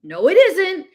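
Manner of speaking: high, loud quarrel retort; loud contradiction. Acted. Girl flatly denies what her friend says, loudly. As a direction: top the other person with a loud denial. Acted back-and-forth quarrel.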